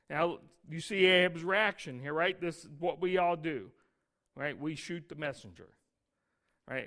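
ordinary talk, nothing marked

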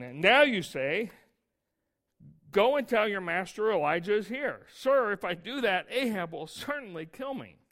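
reading the verse aloud with some force; scripture reading. Acted. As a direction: read it out to the congregation.

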